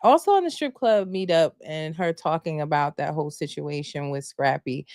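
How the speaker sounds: ordinary talk, nothing marked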